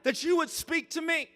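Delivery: high, slow, pleading; pleading Moses. Retold or imitated. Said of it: "Moses is like".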